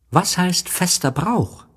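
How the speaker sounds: put-on high childlike story voice; voiced little prince, lifted well up. A narrator's reading. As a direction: pitch it high for a small boy.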